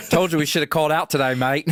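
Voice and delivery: fast, high, loud mock Australian; hammed-up Aussie bloke complaining; Aussie soldier. A soldier to his mates after losing, mock Australian accent.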